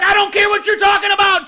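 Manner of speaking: full-volume, high-pitched dismissive yell; retold furious shout. Boss shutting down a screaming employee, furious shout. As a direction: re-enact shouting someone down. Retells yelling at employee to stop.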